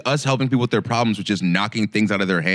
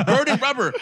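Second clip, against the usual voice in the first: ordinary delivery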